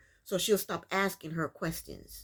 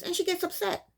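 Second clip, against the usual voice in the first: indignant; 11 semitones higher than usual. Real, not acted. Stressing her reaction.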